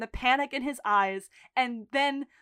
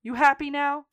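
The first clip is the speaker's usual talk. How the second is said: louder, higher challenge; mock defiant shout; 3 semitones higher, 7 dB louder than usual. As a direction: throw it back at him. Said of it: "Mickey goes".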